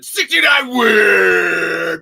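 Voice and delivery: high, loud radio shock jock shout. Retold or imitated. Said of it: "Shock Jock voice".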